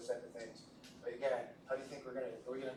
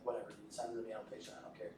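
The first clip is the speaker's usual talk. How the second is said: quick, softer, bored brush-off; a hint of a bored surgeon; dismissive shrug; 4 semitones lower, 8 dB quieter, faster than usual. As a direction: toss it away like it's nothing. Neurosurgeon brushing off the call.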